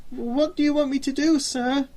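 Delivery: a frightened man asks his boss; trembling question. Acted. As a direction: shrink from the dog. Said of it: "trembling as he stepped nervously".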